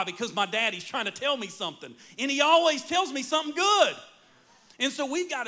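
excited preaching. Real, not acted. Preacher to congregation about God speaking, rising excitement. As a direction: build it up with joy.

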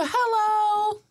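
high sugary shop hello; plastic voice. Retold or imitated. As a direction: chirp it like a clerk. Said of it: "You go".

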